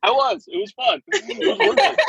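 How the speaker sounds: high-pitched, through real laughter; laughing. Real, not acted. Storyteller admitting the mischief to friends, laughing. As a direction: crack up while answering. Cracking up over own story.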